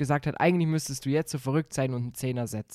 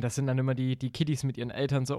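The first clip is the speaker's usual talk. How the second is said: ordinary talk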